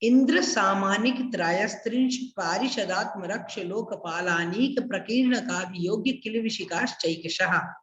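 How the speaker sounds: the whole sutra run together without pauses; said in one go. Shown as a demonstration. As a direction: recite it all in one breath. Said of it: "फिर से सुनना, repeat कर रहा हूं". (एक साथ लगातार बोलना)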